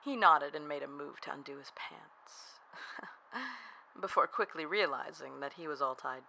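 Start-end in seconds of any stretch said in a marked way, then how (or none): 2.6-4.0 quiet, high giggle; small amused laugh; soft chuckle. Acted. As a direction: laugh lightly under the breath. Narrator amused within the story.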